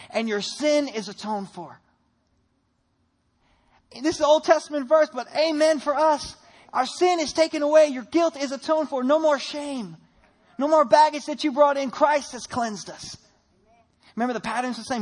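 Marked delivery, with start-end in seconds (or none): 4.0-13.2 preaching at full force. Acted.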